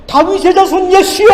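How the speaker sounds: shouted high and loud, calling across a crowd; a blind beggar shouting for Jesus, desperate; desperate cry out. Acted. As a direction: cry out to Jesus at the top of your voice. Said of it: "더 큰 소리로 부르짖습니다".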